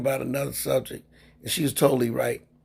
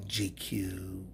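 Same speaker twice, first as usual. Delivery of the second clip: whisper; 7 semitones lower, 13 dB quieter than usual. Real, not acted. Teasing about his calm brother.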